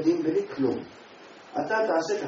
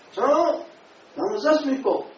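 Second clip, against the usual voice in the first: loud, high call across the room; calling out to the rabbi; 10 semitones higher, 6 dB louder than usual. Retold or imitated. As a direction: call out to get his attention. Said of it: "הפסיק וצעק עליו". (קריאה רמה אל הרב)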